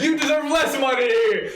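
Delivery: high-pitched mock angry yelling; mock public outrage. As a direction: imitate an indignant crowd shouting back. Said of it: "everyone in the public was like".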